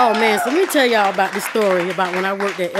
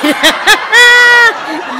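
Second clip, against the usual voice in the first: laughing shout; 11 semitones higher, 7 dB louder than usual. Real, not acted.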